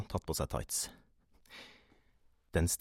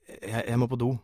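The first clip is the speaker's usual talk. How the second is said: hesitant, higher and louder excuse; flustered mumble; 5 semitones higher, 5 dB louder than usual. A narrator's reading. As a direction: stumble over an embarrassed excuse. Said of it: "mumlet jeg". (forfjamset, nølende mumling)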